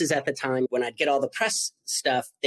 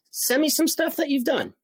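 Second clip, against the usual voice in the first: mimicked publicist; 13 semitones higher than usual.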